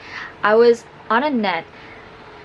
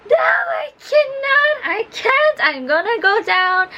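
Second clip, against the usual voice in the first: re-enacted freak-out, pitched way up; panicked refusal. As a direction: squeal it like a hammed-up panic. Acted panicked refusal on the obstacle.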